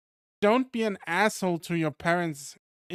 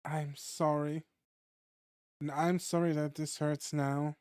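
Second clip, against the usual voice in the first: softer read of the tender scene; story reading; 5 dB quieter than usual. Acted. Player reading the hug scene aloud, softly and gently. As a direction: voice the comfort and the release gently.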